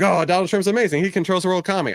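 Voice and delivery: high-pitched fawning fan voice; mock gushing. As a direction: gush in mock admiration. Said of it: "I don't mean to be like".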